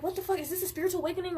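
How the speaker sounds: mock-bewildered questioning; retold bafflement. Retold baffled outburst at guides.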